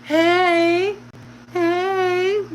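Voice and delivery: put-on high little baby "hey, hey" in a different voice; playful copy of her son in the car seat; imitating baby. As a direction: mimic the baby cooing hey. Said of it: "He'd be like".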